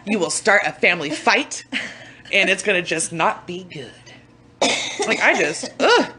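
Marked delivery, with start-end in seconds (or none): 5.8-6.1 high, loud frustrated grunt; exasperated ugh. Real, not acted. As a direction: burst out with a fed-up ugh. Exasperated with the kids.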